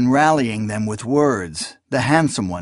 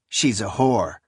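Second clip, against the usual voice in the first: grim; 3 semitones lower than usual. A narrator's reading. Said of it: "his tone grim".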